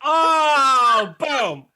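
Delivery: a hyped shout of approval; excited whoop. Real, not acted. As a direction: cheer the zinger like a crowd would. Cheering a friend's joke.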